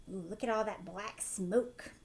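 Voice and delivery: normal talking voice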